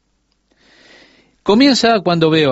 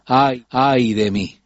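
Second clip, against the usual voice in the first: slow, drawn-out cry of woe; lament; much slower than usual. Acted. Preacher voicing Isaiah before God, slow lament. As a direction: stretch each ay like a man undone.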